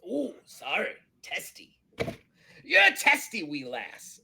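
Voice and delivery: pirate banter leaping high and loud; teasing pirate. Acted. Pirate ribbing a testy crewmate, teasing. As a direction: needle her in a pirate voice.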